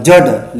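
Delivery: जड़ with a hard final ḍ; wrong stop sound. Shown as a demonstration. Teacher flagging the mistake, जड़ with stopped ḍ. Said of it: "लेकिन ये गलत होगा".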